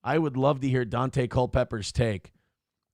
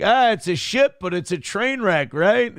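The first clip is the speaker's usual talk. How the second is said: mocking; 5 semitones higher, 4 dB louder than usual. Mocking the filmmakers' cleverness.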